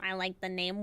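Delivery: normal talking voice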